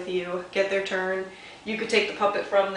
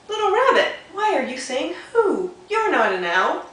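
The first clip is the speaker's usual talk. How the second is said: high, lively owl voice; owl character; 9 semitones higher, 5 dB louder than usual. Acted. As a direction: play the owl, puzzled by the rabbit. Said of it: "Owl says".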